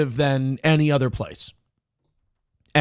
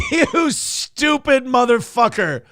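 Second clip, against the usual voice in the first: drawn-out, high furious yelling; outraged yelling at the news clip; furious shout; 9 semitones higher, much slower than usual. Real, not acted. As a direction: scream insults at the screen, stretching every word. Furious at scammed Eagles fan.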